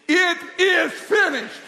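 slow, high, booming proclamation; loud declaration. Shown as a demonstration. Preacher answering hostile circumstances, loud slow declaration. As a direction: shout each word slowly in defiance. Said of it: "we need to speak it out loud".

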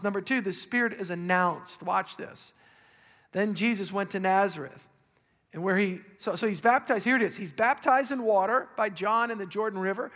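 ordinary talk

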